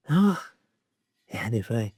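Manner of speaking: panting after lifting a heavy pot; winded, catching breath between words. Real, not acted. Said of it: "sound a little bit out of breath, it's because I am".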